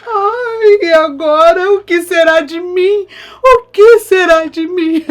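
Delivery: high, loud theatrical lament; hammed-up sobbing despair; mock wailing grief. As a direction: wail over the lost groom. Baratinha hears of death.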